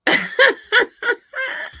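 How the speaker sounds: squealing high laugh; cracked-up high cackle; high shrieking laugh. Real, not acted. Laughing at chat.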